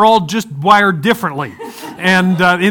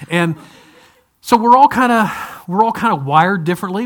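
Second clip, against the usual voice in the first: normal talking voice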